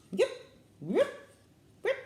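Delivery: short, spaced-out sharp yelps; peacock yelp. Shown as a demonstration. Reader guessing a peacock's call for children, yelping. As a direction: yelp like a peacock, one at a time. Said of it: "What do you think a peacock sound would be?".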